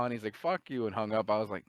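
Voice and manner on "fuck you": higher mock-angry sign-off; curse, pitched up, like hanging up on someone; pissed off. Scammer hanging up on the prankster, pissed off. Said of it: "just pissed off, losing his mind".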